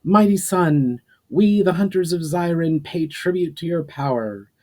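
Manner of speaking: put-on young hunter calling loudly on the sun; ceremonial yell. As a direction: call out a solemn prayer to the sun. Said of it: "Amjo turned towards the sun and yelled".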